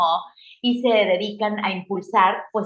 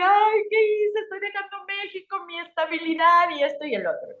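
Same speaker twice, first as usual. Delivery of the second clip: high, strained mock-tearful lament; retold crying; 11 semitones higher than usual. Driving alone on the highway, doubting the move, mock-tearful and high. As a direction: relive the panicky doubt of leaving everything. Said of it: "venía yo llorando así de".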